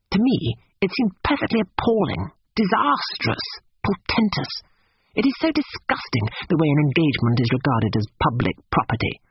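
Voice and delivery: put-on high-pitched, fastidious young gentleman; disgusted Cecil. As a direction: complain loftily, pitch leaping on the condemnations.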